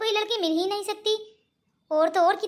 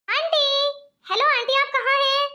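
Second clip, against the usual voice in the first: calling out; 5 semitones higher than usual. Acted. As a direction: call out for someone who isn't answering. Girl calls at empty door. (ऊँची आवाज़ में aunty को पुकारना)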